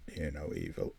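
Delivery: low, soft and hushed; hushed title read. Shown as a demonstration. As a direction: breathe the title quietly. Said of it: "I'll do some acting for yous".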